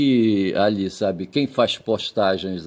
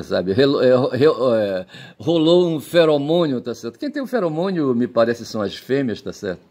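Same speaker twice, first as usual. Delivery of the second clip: ordinary talk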